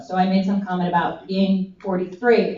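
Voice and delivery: ordinary talk, nothing marked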